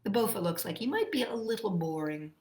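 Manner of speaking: ordinary talk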